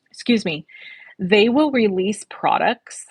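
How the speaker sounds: ordinary talk, nothing marked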